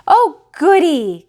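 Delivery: high, loud, stretched joyful cheer; excited children cry. Acted. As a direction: cheer out like a crowd of happy kids. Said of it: "cried the fifteen little Hopkins".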